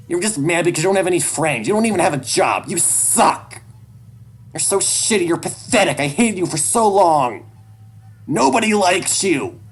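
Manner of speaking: very high, loud screaming insults; hateful screaming. Acted. Son screaming insults at dad.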